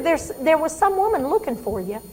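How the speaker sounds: lightly voiced mother, high and bright-pitched; a hint of a confused old mother chatting; chatty old mother. Patient mother chatting to daughter.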